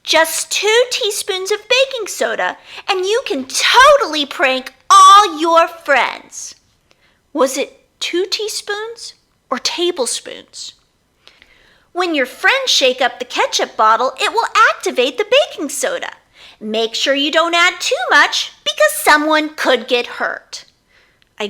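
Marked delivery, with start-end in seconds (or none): 0.0-6.5 higher, upbeat how-to presenter; a lightly voiced online prank tutorial host; video host voice. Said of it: "the instructional video I watched". 11.9-20.6 higher, upbeat how-to presenter; a lightly voiced online prank tutorial host; video host voice. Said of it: "the instructional video I watched".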